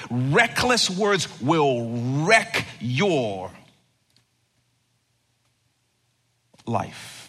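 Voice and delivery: very slow words fading to a breath; slow and dropping to a whisper on life; slow emphasis. Real, not acted. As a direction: land each word slowly. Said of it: "sermon punchline".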